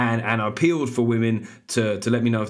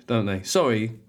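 normal talking voice

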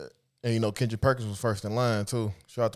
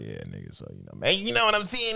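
[name]; 2 semitones lower than usual. Acted. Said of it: "he be like this".

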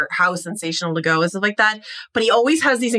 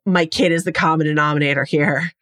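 ordinary talk

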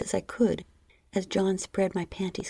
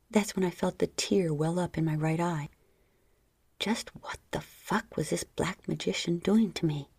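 usual speaking voice

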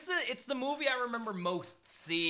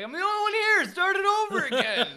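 mock demanding moviegoer; 12 semitones higher, 7 dB louder than usual. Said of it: "Be like".

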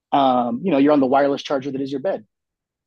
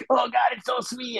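high ecstatic moan; 9 semitones higher than usual. Retold or imitated. Savoring sponge of water.

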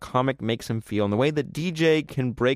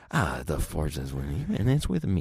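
mock stiff actor line, low and slow; stiff line reading; 7 semitones lower, 5 dB quieter than usual. Mocking an actor's flat line delivery in a low slow voice. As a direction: imitate a wooden movie line, softly. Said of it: "It just sounds like".

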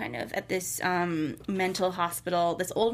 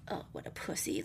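low, soft mock disgust; pissed, retold; 4 semitones lower, 10 dB quieter than usual. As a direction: imitate quiet contempt. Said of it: "you could tell how pissed Michael is".

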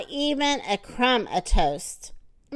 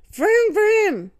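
high, loud engine noise; car engine vroom; 9 semitones higher, 4 dB louder than usual. Acted. Husband dreaming of a fast car, loud engine noise. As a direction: rev like a race car.

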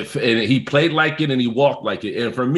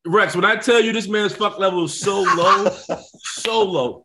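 higher pitched, laughing as he tells it; excited laughing emphasis; 7 semitones higher than usual. Real, not acted. Telling Rex about the coach, excited and laughing. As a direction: crack up while hammering it home.

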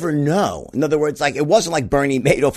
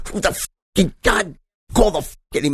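high ranting imitation of a raging dad; mock furious curse; 6 semitones higher than usual. Father interrupted during his cop show, mock rage. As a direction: spit out the curses in mock fury.

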